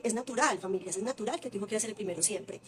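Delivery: ordinary talk, nothing marked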